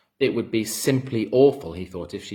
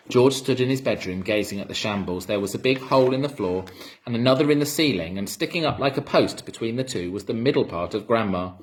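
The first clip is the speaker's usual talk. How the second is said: usual speaking voice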